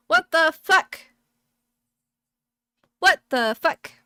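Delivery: gasping, loud, shocked what the fuck; sudden loud shock at the game; shocked outburst. Real, not acted. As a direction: blurt it out in loud disbelief.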